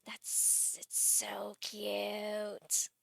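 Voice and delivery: very slow, hushed drawn-out coo; quiet, drawn-out adoration; soft adoring coo. Real, not acted. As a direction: sigh it slowly, melting over cuteness. Winding down from gushing.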